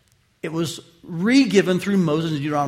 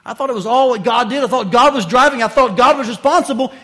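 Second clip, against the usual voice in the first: loud, higher protest, wait wait wait; voicing a confused listener; 5 semitones higher, 9 dB louder than usual. Preacher voicing a congregant's confused objection, raised and loud. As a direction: play the baffled churchgoer objecting.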